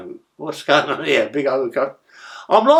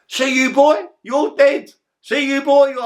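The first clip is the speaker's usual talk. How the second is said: high, louder menacing shout; mock street threat, pitched up and loud; retold threat; 13 semitones higher, 5 dB louder, slower than usual. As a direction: bark the threat like a hard man. Threat shouted by Johnny Crichton.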